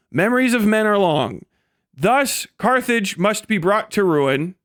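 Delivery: ordinary talk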